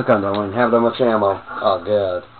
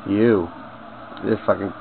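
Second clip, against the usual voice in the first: usual speaking voice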